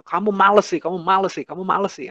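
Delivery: mock nagging at a lazy child; nagging parent. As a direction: scold the lazy child over and over. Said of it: "orang tua juga selalu bilang".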